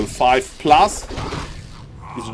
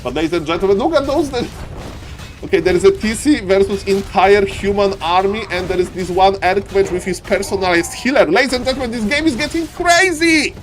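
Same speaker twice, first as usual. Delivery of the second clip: excited play-by-play; 10 semitones higher than usual. Real, not acted. Caster shouting the big teamfight to viewers, frantic and higher. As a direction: call every kill like the game's on the line.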